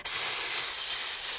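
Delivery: long sustained hiss; angry serpent hiss. A narrator's reading. Serpent answering the children, hissing angrily. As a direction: hiss like an angry snake, drawn out. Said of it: "the serpent hissed angrily".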